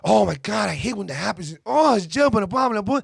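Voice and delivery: a hint of an over-excited woman; mocking girlfriend. Mimicking the girl gushing during the movie, mock excited. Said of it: "it was like".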